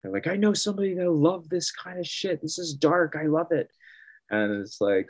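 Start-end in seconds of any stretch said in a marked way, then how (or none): 0.3-3.7 much higher put-on fan voice; a goth fan thrilled by dark art; imitating an excited fan. Imitating fans at booth.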